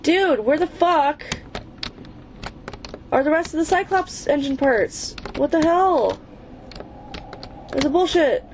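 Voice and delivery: high, fed-up complaint; exasperated rant. Real, not acted. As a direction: vent real frustration at the game. Can't find the engine parts.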